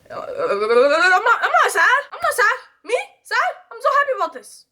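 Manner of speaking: very high, squeaky fake-tearful denial; mock holding back tears. Younger self fighting off the tears, hammed-up high tearful denial. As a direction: pretend to insist you're fine while nearly crying.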